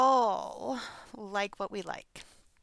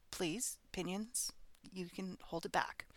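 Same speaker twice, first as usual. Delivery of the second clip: murmured, much softer aside; hushed aside; 2 semitones lower, 9 dB quieter than usual. Real, not acted. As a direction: tell yourself to hush, under your breath.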